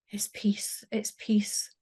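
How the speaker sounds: soft breathless whisper; whispered thought. Retold or imitated. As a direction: breathe it out in relief. Lying there thinking after the purge.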